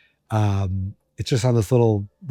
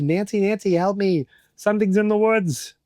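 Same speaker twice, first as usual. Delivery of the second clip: panicky call for help; 8 semitones higher than usual. Pretend.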